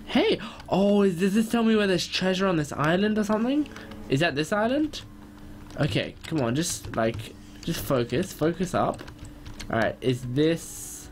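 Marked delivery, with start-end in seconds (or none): 0.1-5.0 excited. Real, not acted. Treasure map excitement.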